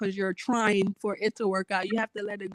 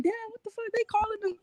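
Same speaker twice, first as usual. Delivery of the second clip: irritated; 11 semitones higher, 5 dB quieter, faster than usual. Retold or imitated. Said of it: "But then I'm like".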